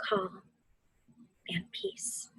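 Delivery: barely voiced, fading whisper; soft whisper. Teacher easing listeners into stillness, whispered. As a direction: let the words melt into breath. Meditation, final soothing words.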